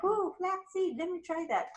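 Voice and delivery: high-pitched, intrigued "ooh" voice; imitated intrigued shopper. Acts out a shopper spotting an item.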